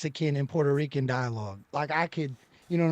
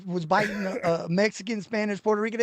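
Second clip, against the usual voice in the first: ordinary talk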